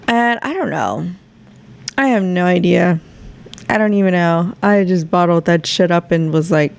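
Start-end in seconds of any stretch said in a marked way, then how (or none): none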